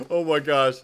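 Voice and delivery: ordinary talk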